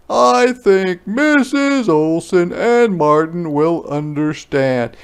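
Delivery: high, loud, slow comfort; a father calming his daughter; high slow reassuring father; reassuring Papa. Acted.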